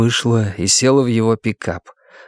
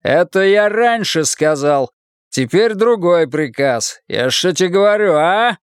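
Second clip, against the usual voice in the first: bullying demand; 6 semitones higher than usual. A narrator's reading. Husband giving wife a new order, bullying. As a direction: press her for an answer.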